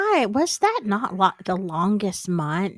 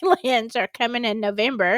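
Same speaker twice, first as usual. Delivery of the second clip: ordinary talk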